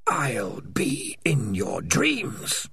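slow menacing whisper; eerie whisper. Acted. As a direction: breathe the threat slowly. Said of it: "came the voice of Spider".

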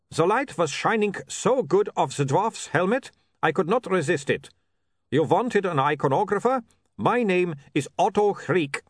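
put-on light Uberwald vampire accent, formal and eager; a keen young vampire from Uberwald, lightly voiced; Otto's Uberwald accent. A narrator's reading. Vampire photographer introduces himself.

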